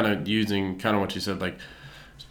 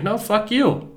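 much higher, mock-indignant comeback; retold angry retort; 12 semitones higher, slower than usual. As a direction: fire it back, imitating a quarrel. Retold comedian's retort in quarrel.

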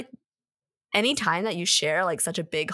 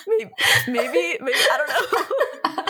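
high, laughing and louder; 10 semitones higher, 7 dB louder than usual. Real, not acted. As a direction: giggle through the words. Embarrassed laughing answer.

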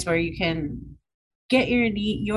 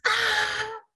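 mock crazy yell; 12 semitones higher than usual. Friend showing a bride how to yell in the car, mock crazy yell. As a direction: let out a held-back, high pretend yell. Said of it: "crazy yell in my car".

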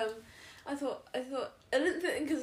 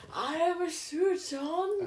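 slow, drawn-out, higher alien voice; Doctor Who alien; 5 semitones higher, much slower than usual. Acted. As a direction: announce it like a sci-fi alien, stretching each word. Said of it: "sounds like sort of like Doctor Who alien".

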